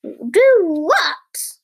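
plain reading voice

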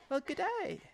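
high-pitched put-on Australian accent; Simon, Australian accent. Host voices the new assistant saying hello, Australian accent. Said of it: "he has an Australian accent".

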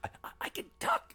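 whispered halting stutter; breathless stammered whisper. Acted. Joking as stuttering Billy.

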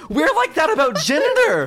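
squealing with laughter; cracking up mid-sentence; laughing. Real, not acted. Repeated while laughing hard.

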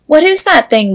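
high, alarmed question; alarmed exclamation. Acted. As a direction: cry out at the monster. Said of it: "Willow exclaimed".

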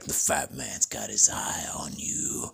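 slow low whispered threat; menacing whisper. Retold or imitated. Santa whispering a warning to the kid, menacing. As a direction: breathe the threat like a sinister Santa. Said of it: "and he's like".

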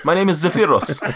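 amused apology in a put-on accent; Zephyros. Giant belatedly introducing himself, high accented voice. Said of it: "my accent's just all over the place".

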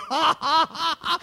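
acted high-pitched ha-ha laugh; imitated laughter. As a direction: play Jesus laughing on his throne. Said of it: "he'll be like".

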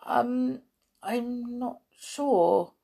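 hesitate, then admit it slowly; hesitant. A narrator's reading. Tom answers matron about his parents, hesitant. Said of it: "The boy hesitated before answering".

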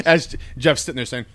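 ordinary talk

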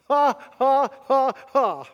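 loud, high, booming mocking laugh; God laughing. God in heaven laughing loudly at raging nations.

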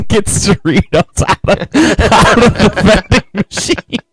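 high, slow words squeezed through laughter; real helpless giggles; laughing while talking. Real, not acted. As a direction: barely get the punchline out for laughing. Cracking up at own punchline.

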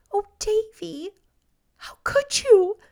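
high, tearful reproach; quivering voice. A narrator's reading. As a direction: plead through rising tears. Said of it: "in a quiver in her voice".

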